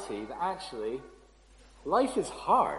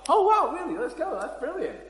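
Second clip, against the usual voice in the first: high, gushing mock delight; mock thrilled wife; 12 semitones higher than usual. Wife hearing the vicar's prophecy, mock overjoyed. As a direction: play the over-the-top happy reaction she didn't have. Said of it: "my wife's reaction to this news isn't like".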